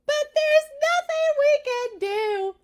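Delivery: very high, loud wailing protest; frightened people screaming; desperate scream. Acted. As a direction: howl it in despair. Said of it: "they screamed".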